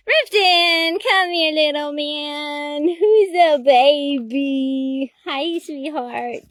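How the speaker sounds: very slow, stretched-out coo to a dog; drawn-out pet voice. Real, not acted. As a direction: call the little dog over, loud then cooing. Cooing to her dog.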